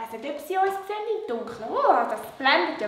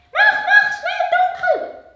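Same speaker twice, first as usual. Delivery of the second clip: high, louder puppet urging; excited puppet voice; 11 dB louder, slower than usual. Acted. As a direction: hurry him in a high puppet voice. Puppet Lola urges.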